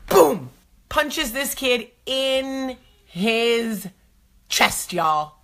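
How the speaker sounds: ordinary talk, nothing marked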